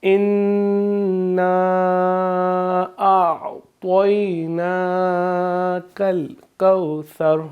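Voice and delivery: tajweed recitation. Shown as a demonstration. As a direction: recite each word slowly with held vowels. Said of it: "اب میں تلاوت کروں گا".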